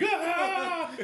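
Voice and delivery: very high, loud mock scream; imitated startled scream. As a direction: scream back in shock. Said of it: "screams back at me".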